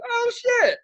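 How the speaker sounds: high, loud mock astonishment; overdone loud reaction. Retelling how he reacted to the coworker, overdone. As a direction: crow it across the bar. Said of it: "really doing it bad".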